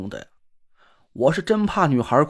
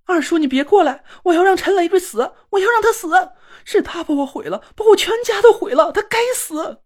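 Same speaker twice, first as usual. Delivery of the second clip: much higher, screaming rage; hysterical roar; 12 semitones higher, 4 dB louder than usual. Acted. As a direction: scream it out, beside yourself. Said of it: "歇斯底里地大吼了起来".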